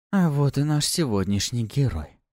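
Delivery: cooing seductive succubus; breathy purring seductress. A narrator's reading. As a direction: purr it like a temptress. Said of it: "проворковала суккуба".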